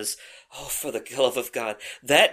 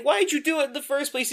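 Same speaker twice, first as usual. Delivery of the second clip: put-on hurt girlfriend; 11 semitones higher than usual. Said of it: "And she's like".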